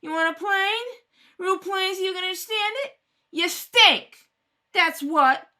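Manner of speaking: slurred yelling at the officers; drunken shouting. Acted. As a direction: spit it out at all of them. Drunken mother shouts insults.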